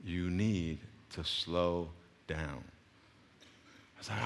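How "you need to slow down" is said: low, soft, very slow instruction; quiet, deliberate, patient order; slow coach's correction. Retold or imitated. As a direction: imitate a coach spacing out each word. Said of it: "She said".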